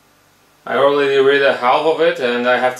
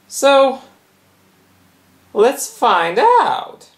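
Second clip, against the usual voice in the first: very high, slow menacing voice; creepy toy threat; 12 semitones higher, much slower than usual. Acted. Fidget spinner with a knife threatening him, high and slow. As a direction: draw out the threat sweetly.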